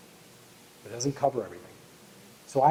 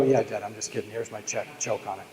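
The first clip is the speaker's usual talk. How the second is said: low, soft, sneering sarcasm; smug comeback; 6 semitones lower, 10 dB quieter than usual. Pretend. Son gloating to dad with his check, lower and soft. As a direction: hand him the check and gloat quietly.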